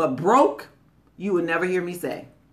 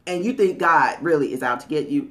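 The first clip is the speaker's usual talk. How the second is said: ordinary talk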